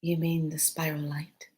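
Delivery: hushed whispered question. Retold or imitated. Beings asking her about the gift, whispered. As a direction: breathe the question in awe.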